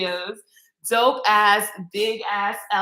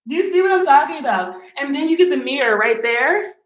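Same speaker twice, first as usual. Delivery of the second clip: much higher and louder, thrilled; excited gushing; 7 semitones higher, 5 dB louder than usual. Real, not acted. Showing viewers her favorite room, the shower, gushing excitedly. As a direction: show it off like you can't believe it.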